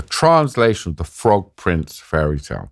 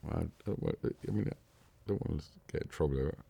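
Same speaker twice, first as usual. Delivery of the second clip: much softer, under-the-breath aside; hushed aside; 5 semitones lower, 20 dB quieter, faster than usual. Real, not acted. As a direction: drop the voice right down, as if not to be overheard. Aside breaking off the retold swearing.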